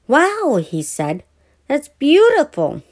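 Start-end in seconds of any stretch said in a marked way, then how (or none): 0.1-0.6 put-on high, bright child's wow; excited wow. A narrator's reading. Little boy admiring a big model plane, excited. 1.7-2.8 put-on high, louder little boy in awe; young boy voice. A narrator's reading. Billy telling Brother the plane is beautiful, awed.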